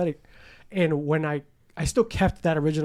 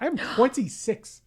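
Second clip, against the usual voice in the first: higher, slow, incredulous; 7 semitones higher, much slower than usual. Retold or imitated. Telling the doctor he is only twenty-six, in disbelief. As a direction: stretch it out in disbelief.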